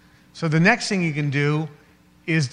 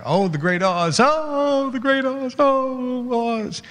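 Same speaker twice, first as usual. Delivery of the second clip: much higher, awestruck chant of the great Oz; mock awe of Oz; 9 semitones higher, slower than usual. Speaker mocking awe of the great Oz, high and hushed. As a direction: swoon over the great Oz in a high voice. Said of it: "Think of the great Oz".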